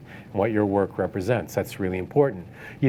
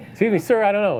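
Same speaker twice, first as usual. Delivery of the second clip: put-on flustered gallery girl; 9 semitones higher, faster than usual. Receptionist panicking over the packet left on the counter, mock flustered and higher. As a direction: play it like she's been handed a bomb.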